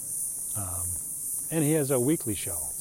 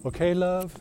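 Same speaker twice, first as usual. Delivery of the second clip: lightly imitated British "love", lifted and louder; British phrasing, imitated; 8 semitones higher, 5 dB louder than usual. Nurse to patient's parent, lightly imitated British accent. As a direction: slip in a higher, British-accented endearment. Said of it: "that's definitely British".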